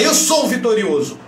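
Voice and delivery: forceful self-affirmation. Shown as a demonstration. Coach showing a victorious affirmation with head up, forceful. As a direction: declare it with your head held high. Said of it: "Muda o tom da tua voz".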